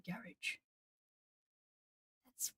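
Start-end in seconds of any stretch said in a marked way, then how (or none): 0.0-0.6 low, soft "garage" as GARR-ij; own usual pronunciation. Shown as a demonstration. Streamer concluding how they sounded, soft "garage" as GARR-ij. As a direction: say "garage" with stress first and -ij ending. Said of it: "I probably sounded like".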